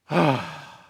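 loud put-on sigh of reluctance; exasperated sigh. Preacher plays a reluctant Jesus sent to earth, exasperated sigh. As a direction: heave a hammed-up weary sigh.

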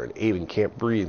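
usual speaking voice

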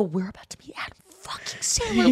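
breathless whisper, no voice at all; excited whisper. Retold or imitated. Whispering to friends as the celebrity nears, thrilled. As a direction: hiss it like a secret you can't hold.

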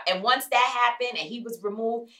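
ordinary talk

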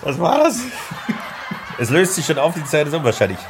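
laughing. Real, not acted. Decoration falls mid-recital, laughing.

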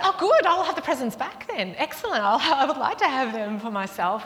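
loud, high, exaggerated sarcasm; mock-delighted retort. Aunt to sulking child, taking presents back, sarcastic. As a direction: crow it back at the child, hammed up.

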